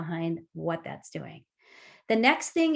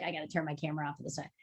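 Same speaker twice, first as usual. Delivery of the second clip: mumbled aside; 4 semitones lower, 11 dB quieter, much faster than usual. Pretend. Imagined shy participant.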